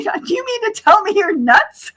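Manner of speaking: high-pitched mock disbelief with a laugh behind it; incredulous retold quote. Retelling the line to her friends in amused disbelief. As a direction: replay the punchline in a disbelieving voice.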